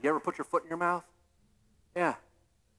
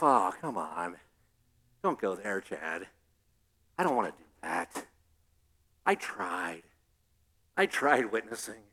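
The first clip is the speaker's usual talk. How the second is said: lower, softer reluctant churchgoer whining; whiny excuse voice; 12 semitones lower, 7 dB quieter than usual. Retold or imitated. Churchgoer making excuses to the pastor, in a low softer whine. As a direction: mumble the excuse like someone dodging duty.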